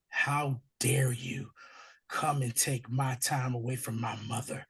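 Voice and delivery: hushed slow whisper of resentment; jealous inner voice. Retold or imitated. Jealous Oz resenting his brothers, hushed whispered mutter. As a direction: mutter it under your breath, slowly. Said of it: "You saw Oz's whole demeanor change".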